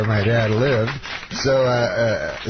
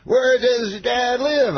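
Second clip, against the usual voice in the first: much higher, slow Cosby voice; Cosby impression; 12 semitones higher, slower than usual. Asking the young comic, slow mock Cosby. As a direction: ask it like Cosby, drawn out.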